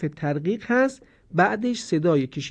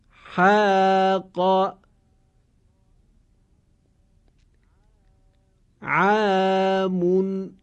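very slow, drawn-out recited words; recitation drill; 3 semitones higher, much slower than usual. Shown as a demonstration. Teacher leading a slow recitation drill. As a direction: chant each word slowly for students to repeat. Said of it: "شما هم حتماً با من تمرین کنید".

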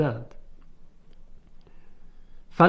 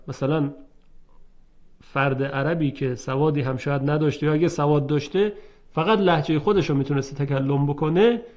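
ordinary talk, nothing marked

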